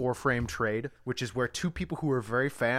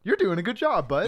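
patronizing praise; 6 semitones higher, 4 dB louder than usual. Retold or imitated. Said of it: "faintly praises him".

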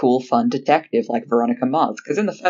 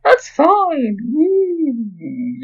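much higher, slow ghostly moan; 13 semitones higher, 5 dB louder, much slower than usual. Acted. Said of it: "keep doing ghost sounds in the background".

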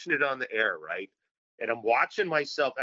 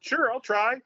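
put-on higher, eager little kid; 11 semitones higher, 3 dB louder than usual. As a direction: happily say yes like a child. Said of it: "kid accepting a cigarette".

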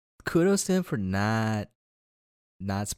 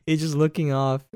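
ordinary talk

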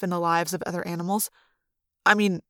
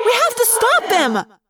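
very high, slow, drawn-out shout; outraged cry; 14 semitones higher, 4 dB louder than usual. A narrator's reading. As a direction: cry out a desperate demand. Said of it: "Cassie cried, outraged".

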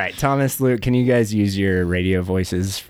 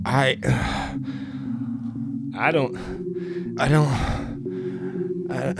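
higher, faltering voice with a breathy sigh; acted weakness, trailing into sighs; weak and dazed; 6 semitones higher, 5 dB quieter, slower than usual. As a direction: struggle to get the words out, dazed. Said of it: "he looks extremely weak and dazed".

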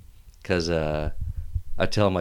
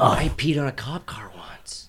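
whispered confession; 3 semitones higher, much slower than usual. Real, not acted. Confessing in a hushed aside.

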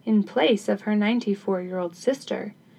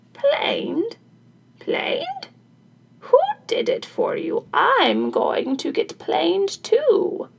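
put-on very high-pitched, eager old woman; astonished old crone; 10 semitones higher, slower than usual. Crone learns sister's secret, high astonished voice. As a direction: shoot up high in disbelief, then stretch out the resolve.

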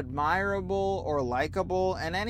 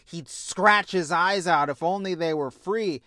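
normal talking voice

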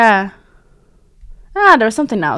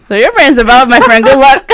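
loud, teasing congratulations with a laugh; 4 semitones higher, 10 dB louder than usual. Real, not acted. Teasing friend about brain, loud and laughing. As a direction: rib your friend loudly, grinning.

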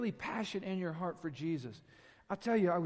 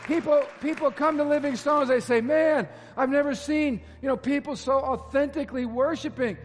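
louder, higher fervent preaching; full-force preaching; 6 semitones higher, 9 dB louder than usual. Real, not acted. Pastor exhorting his congregation, fervent loud preaching. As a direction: preach it out with rising urgency.

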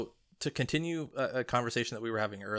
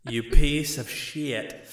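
mock outrage; 4 semitones higher, 10 dB louder, slower than usual. Real, not acted. Teasing friend about faction loyalty.

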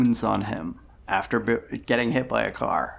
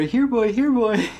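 high, louder sing-song dog call; 8 semitones higher, 4 dB louder than usual. Retold or imitated. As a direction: call it like whistling up a pet. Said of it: "and they go".

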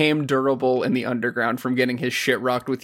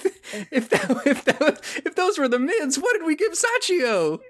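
high laughing squeak of disbelief; 13 semitones higher than usual. Real, not acted. Friend cracking up at his own joke, high and laughing.

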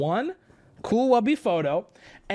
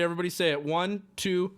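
usual speaking voice

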